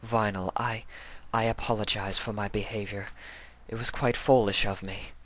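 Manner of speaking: quietly collecting herself; calm apology. Acted. Octavia apologising to Vinyl after crying, calm and subdued. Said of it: "She spoke calmly while wiping her eyes".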